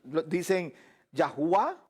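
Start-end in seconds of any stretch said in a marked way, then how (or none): 1.1-1.8 Yahuwah said as ya-HU-wah; "Yahuwah" ending in an open wah; Yahuwah pronunciation variant. Shown as a demonstration. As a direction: say Yahuwah with an "oo" in the middle. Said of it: "Hay personas que lo, dicen".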